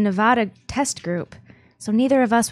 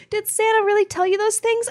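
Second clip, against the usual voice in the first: ordinary talk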